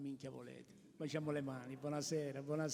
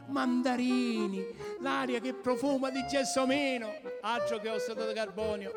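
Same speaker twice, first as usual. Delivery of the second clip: excited; 6 semitones higher, 3 dB louder than usual. Acted. Rapturous praise of Sicily.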